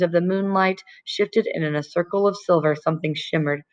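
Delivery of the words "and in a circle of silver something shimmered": ordinary delivery, nothing marked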